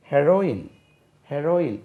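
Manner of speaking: ஹெரோயின் said he-RO-in, short first vowel; correct heroine pronunciation. Shown as a demonstration. Teacher contrasting it with hero for pupils, correct short "he". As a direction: say ஹெரோயின் with "he", never a long "hee". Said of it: "அதுக்கு உச்சரிப்பும் ஹெரோயின் தான்".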